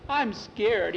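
high-pitched, worried confession; scared boy. A narrator's reading. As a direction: let fright lift the voice. Bowie admits fear to Skeeter.

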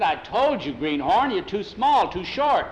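acted impatient repeat, punching the key words; exasperated insistence. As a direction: drive each reason home to someone who won't listen. Hugo repeats rejection, exasperated.

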